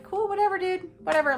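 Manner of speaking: dismissive. Retold or imitated. Wife brushing off husband's excuse, high and dismissive. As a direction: toss it off like it doesn't matter. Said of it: "And I was like".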